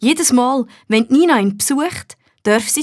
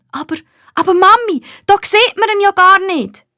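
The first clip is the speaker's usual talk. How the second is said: put-on high, louder whiny protest from a little girl; a girl let down, pleading fast; disappointed child; 10 semitones higher, 4 dB louder than usual. A narrator's reading. Nina protests to mum about hiding the gnome, disappointed child. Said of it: "sagt Nina enttäuscht".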